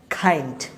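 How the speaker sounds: "kind" opening on a breath-puffed kh; aspirated k. Shown as a demonstration. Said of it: "এটাকে বলবো হচ্ছে". ("kind" শুরুতে খ উচ্চারণ)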